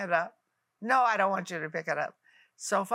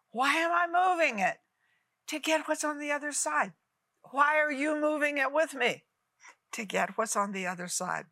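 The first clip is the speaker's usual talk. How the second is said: rhetorical call and response; 5 semitones higher than usual. Acted.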